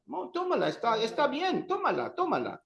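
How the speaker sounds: high, insistent urging to take the beer; insistent coaxing. Pretend. Offering a new beer to a friend, insistent coaxing. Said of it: "you're talking about cerveza".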